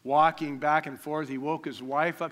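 usual speaking voice

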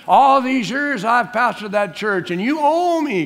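high, loud, drawn-out demand; hammed-up aggrieved pastor claiming a debt; mock entitlement. Mocks an entitled pastor to God.

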